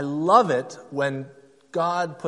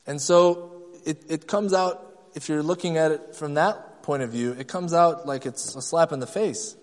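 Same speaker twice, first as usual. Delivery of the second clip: usual speaking voice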